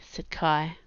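ordinary delivery

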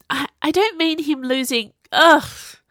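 high-pitched, flustered exasperation; stumble, then burst out in frustration; furious. A narrator's reading. Viola tripping over her words to Sage, furious. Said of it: "she said furiously".

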